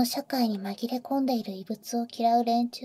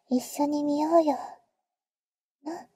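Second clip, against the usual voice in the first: usual speaking voice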